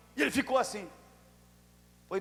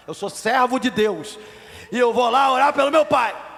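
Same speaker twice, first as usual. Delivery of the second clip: building to a loud, raised proclamation; defiant declaration; 4 semitones higher, 8 dB louder than usual. Retold or imitated. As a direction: start firm, then boom it out. Retells defying the doctor.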